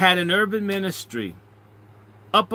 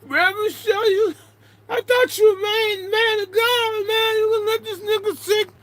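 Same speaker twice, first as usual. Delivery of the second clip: much higher, crying plea; desperate plea. Retold or imitated. Thief pleading with the reverend, high tearful wail. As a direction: wail at the preacher for help. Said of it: "and he says".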